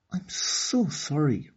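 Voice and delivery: put-on mild apologetic girl. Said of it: "replied Okani".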